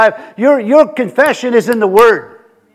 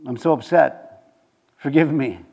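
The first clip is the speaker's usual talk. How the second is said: soft, low mock penitence; acted remorseful prayer, subdued; feeling bad; 7 semitones lower, 8 dB quieter than usual. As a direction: beg forgiveness quietly and low. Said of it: "and you feel bad".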